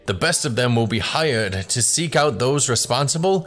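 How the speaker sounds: formal, ceremonious elder addressing the crowd; stately public announcement, slowing at the end; village elder's speech. Acted. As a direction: speak like a village patriarch opening a festival.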